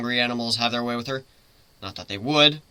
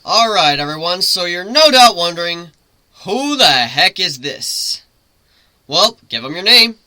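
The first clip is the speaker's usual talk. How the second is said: playing it up; 3 semitones higher, 7 dB louder than usual. A narrator's reading. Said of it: "play it up a bit".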